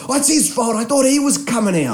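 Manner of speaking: high, imitated whiny protest; mock excuse-making. Other driver blaming man in driveway, mock whine. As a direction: shift the blame in a put-on voice.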